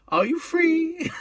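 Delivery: very high, slow, camp sing-song; John Inman impression. John Inman hailing the cabbie at King's Cross, very high and slow. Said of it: "John Inman come along and said to him".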